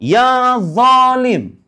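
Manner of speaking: teacher demonstrating the vocative; drawn-out Arabic call. Shown as a demonstration. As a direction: sing out ya zalim like a call. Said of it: "Ya rojul".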